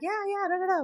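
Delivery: high, soft, dismissive yes; half-hearted high agreement; mock agreeing. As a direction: humour her absently. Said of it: "we're like".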